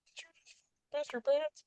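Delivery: high, soft mocking instructor voice; quiet mock drill instructor. Instructor confronting the trainee quietly, high squeaky mock voice. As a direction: hiss it quietly in his face, squeaky. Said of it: "but kinda quiet".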